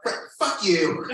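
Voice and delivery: indignant comic outburst; mock outrage. Bitter retort to being friend-zoned.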